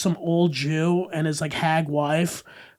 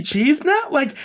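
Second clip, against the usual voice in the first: ordinary talk, nothing marked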